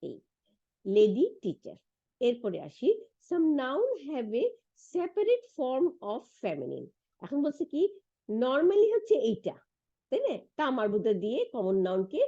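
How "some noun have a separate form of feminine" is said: slow, word by word English reading; slow textbook reading. Shown as a demonstration. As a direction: read the book aloud to the class slowly. Reads English textbook line. (ধীরে ধীরে বই থেকে পড়া)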